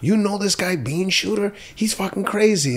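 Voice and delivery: high-pitched imitation of astonished askers; mock amazement, pitch jumped right up; incredulous gossip. People asking the host about Bean Shooter, incredulous.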